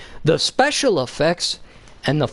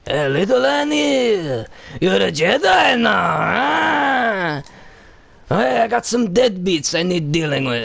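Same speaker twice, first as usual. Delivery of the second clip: Watto impression; 10 semitones higher, slower than usual. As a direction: ham up a greedy junk dealer taunting. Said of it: "and Watto's like".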